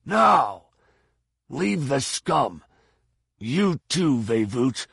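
high, forceful commanding shout; shouted orders. Acted. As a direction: shout urgent orders through a closed hatch. Fett orders men through shut hatch.

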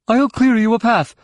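high, loud call to an ally; battle shout. A narrator's reading. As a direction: shout it over the fighting.

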